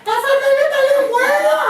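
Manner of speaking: a loud stage whisper; mock whisper, actually loud. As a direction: call it out high and loud while pretending to whisper. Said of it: "I would so-called whisper".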